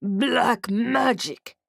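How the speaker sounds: slow, heavy orc words. Acted. Orc whispering a secret to narrator, slow orc voice. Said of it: "he said".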